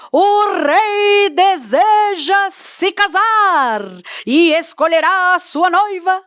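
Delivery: loud, high, very slow herald's cry; royal proclamation. Shown as a demonstration. Herald announces to the people that the king will marry, loudly. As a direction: proclaim it to the crowd below. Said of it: "tem uma intenção de voz mais alta".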